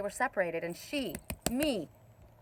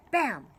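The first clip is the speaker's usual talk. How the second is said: a sharp, louder "Bam!" like a shot; mock gunshot; 4 semitones higher, 5 dB louder than usual. Telling viewers her dream, she mimics the gunshot sharply. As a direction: punch it out like a rifle crack.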